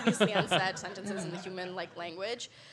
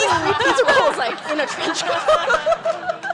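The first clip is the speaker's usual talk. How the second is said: laughing; 10 semitones higher, 10 dB louder, slower than usual. Real, not acted. Laughing punchline.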